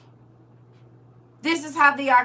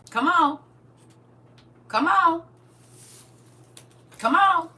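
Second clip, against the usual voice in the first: "Come on" building higher and louder each time; rising preacher's call; 7 semitones higher, 5 dB louder than usual. Real, not acted. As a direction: call them in harder and harder, pulling the crowd along.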